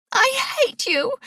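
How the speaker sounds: put-on a girl screaming her hatred at the top of her voice; high furious shriek; furious cry. A narrator's reading. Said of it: "Sarah's furious cry".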